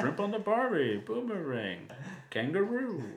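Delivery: high, exaggerated Aussie accent; mock Australian accent. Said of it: "so the Australian accent".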